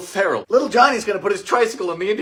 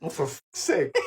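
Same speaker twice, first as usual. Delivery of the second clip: usual speaking voice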